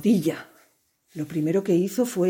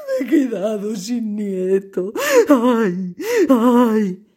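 high, slow sobbing lament; wailing grandfather; 12 semitones higher, 4 dB louder, slower than usual. A narrator's reading. Grandfather mourning an unborn grandson, high slow wailing. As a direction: wail for the lost grandson. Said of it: "lloraban y lloraban".

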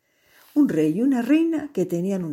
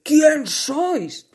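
angry; 9 semitones higher, 5 dB louder than usual. Acted. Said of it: "le dijo el rey".

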